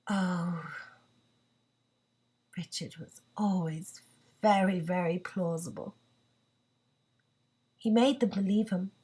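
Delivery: low, soft, with long pauses; subdued resignation. Acted. Laura admits Richard was always plausible, low and resigned. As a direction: let it sink low and quiet, spacing the words. Monologue's resigned close about husband.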